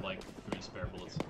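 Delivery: soft whisper of ammo count. Real, not acted. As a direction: whisper it under your breath.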